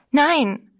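a sharp, high, loud cry of no; scream. A narrator's reading. Girl refusing the narrator's talk of Jesus, screaming. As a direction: refuse him with a piercing shout. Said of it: "schrie sie mit einer Stimme".

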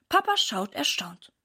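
ordinary delivery

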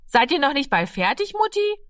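put-on high child's call from outside the door; a child calling to Mutti through the door. A narrator's reading. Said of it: "rief es von der Tür".

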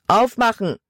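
a man pounding on the door, yelling; shouted demand. A narrator's reading. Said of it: "rief ein Mann". (lauter Befehl an der Tür)

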